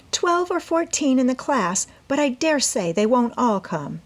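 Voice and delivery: put-on eager young woman explaining her plan to her mother; Amy's voice. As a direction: plead your case brightly as the eager daughter. Amy answers.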